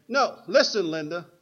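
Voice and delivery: loud, high mimicry on the first words; insistent child. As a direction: imitate a bossy boy demanding to be heard. Quotes the boy's message on shrine.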